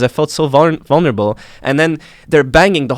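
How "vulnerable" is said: ordinary talk, nothing marked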